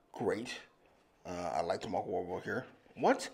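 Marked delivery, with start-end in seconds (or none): 1.2-2.7 mumbled. Real, not acted. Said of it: "I'm a mushmouth".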